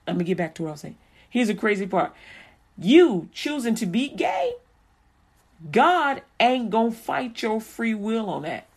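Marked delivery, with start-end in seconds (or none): none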